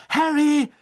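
high, loud panting call; breathless cry. A narrator's reading. Hermine arrives out of breath, calling Harry. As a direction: gasp his name out, winded from running. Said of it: "keuchte sie".

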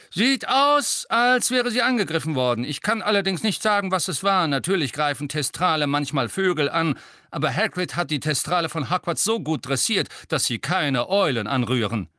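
high, loud no-nonsense voice; brisk gruff teacher. A narrator's reading. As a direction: bark the question like a gruff vet. (resolute Lehrerin, laut)

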